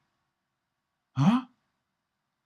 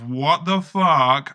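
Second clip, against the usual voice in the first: loud, slow, stunned what the fuck; louder, drawn out like a gawking reader; mock shocked reader; 8 dB louder, slower than usual. Imagined newspaper readers reacting, loud and slow.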